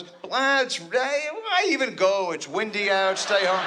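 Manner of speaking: high, slow whiny nag; a car that'd rather stay home; kvetching car. Retold or imitated.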